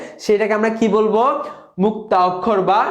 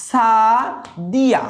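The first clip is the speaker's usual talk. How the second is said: Sadia stretched very slowly, final vowel held long; a slow stretched Sadia showing the open final vowel; drawn out; much slower than usual. Shown as a demonstration. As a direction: pull out every sound of Sadia slowly. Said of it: "টানা যাবে".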